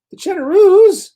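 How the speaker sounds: high shocked question, New York accent optional; surprised. Real, not acted. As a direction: blurt it out in disbelief. Chat window suddenly gone.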